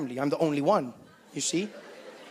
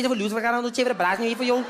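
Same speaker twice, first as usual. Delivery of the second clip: loud, higher-pitched mock Cape Town patter; rattling off; 4 semitones higher, 3 dB louder than usual. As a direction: rattle off a baffling stream of slang. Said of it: "But they just rattle off".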